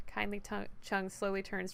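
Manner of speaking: ordinary delivery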